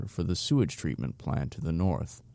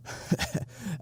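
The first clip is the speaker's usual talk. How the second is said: risita breve y aireada; short chuckle; 11 semitones higher, 6 dB quieter than usual. Acted. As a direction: se ríe por lo bajo, incrédulo. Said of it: "Stu coughed a bit as he chuckled".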